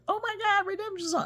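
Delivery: high, loud squeal of delight; excited fan gushing. Retold or imitated. Fan on the plane, squealing over the novel, excited. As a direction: gush like a starstruck fan. Stranger gushes over the book.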